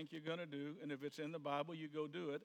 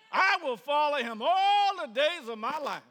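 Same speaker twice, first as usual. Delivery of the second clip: high, loud whooping joy, mock-exultant; ecstatic cheering; 11 semitones higher, 15 dB louder, slower than usual. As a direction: shout it out like someone who just got saved. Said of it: "Well, you would think".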